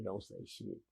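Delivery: whisper-quiet caution to a friend; hushed warning. Retold or imitated. Telling his cellmate to keep quiet, hushed.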